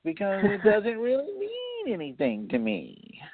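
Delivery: put-on high, slow nasal whine of a spoiled kid; whiny voice. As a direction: whine it out like an entitled teenager. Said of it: "that's my whiny voice".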